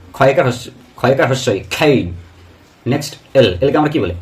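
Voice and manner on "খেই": খেই as aspirated "khay-ee"; correct pronunciation. Shown as a demonstration. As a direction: puff and glide on খেই. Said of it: "কিন্তু কে নয়". (খেই-র সঠিক উচ্চারণ, শ্বাস দিয়ে)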